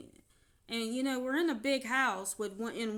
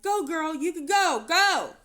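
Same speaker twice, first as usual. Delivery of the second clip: loud, higher cheering; cheering bystanders; 8 semitones higher, 13 dB louder than usual. Retold or imitated. Bystanders urging her to leave him, loud cheering. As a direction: urge her on like a crowd.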